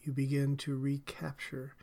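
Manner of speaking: normal talking voice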